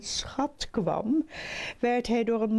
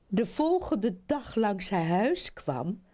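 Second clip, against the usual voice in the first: ordinary delivery, nothing marked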